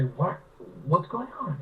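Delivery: high, baffled little cry; bewildered kid. Retold or imitated. Boys asking Pete what is going on, high and bewildered. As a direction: sound like a lost, confused boy. Confused kids question Pete.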